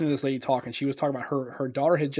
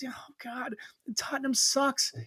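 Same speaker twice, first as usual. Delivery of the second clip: mock exasperation; 8 semitones higher, 6 dB quieter, slower than usual. Imagined heckling of the lady.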